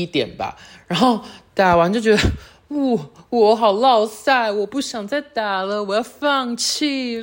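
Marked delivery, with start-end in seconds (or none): 2.7-7.2 higher, slow, whimpering self-pity; hammed-up dejected whine; mock whiny despair. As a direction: play up a hammed-up sulk after losing. Retelling her defeatist self-talk.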